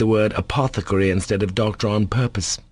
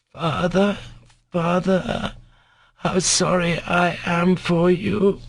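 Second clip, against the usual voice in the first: moaning bitterly; 10 semitones higher, slower than usual. A narrator's reading. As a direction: moan it out, sick and sorrowful. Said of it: "Ilusha moaned bitterly".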